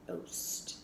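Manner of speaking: whispered sound. Shown as a demonstration. Teacher repeating the nonsense word: whispered "Oost".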